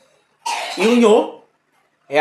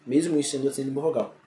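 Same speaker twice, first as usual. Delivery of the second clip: Mesmo o i sendo uma semivogal, muttered softer and faster; quiet fast repeat; 3 semitones lower, 9 dB quieter, much faster than usual. Shown as a demonstration. Teacher quickly repeating the point to the students, much softer. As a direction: echo it quickly under your breath. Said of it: "repeated explanation".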